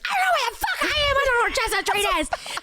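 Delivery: frantic high yell, half crying; retold scream crying. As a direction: shriek it out like you're lost and panicking. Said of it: "scream crying into the phone".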